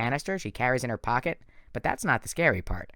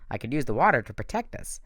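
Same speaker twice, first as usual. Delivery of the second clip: ordinary delivery, nothing marked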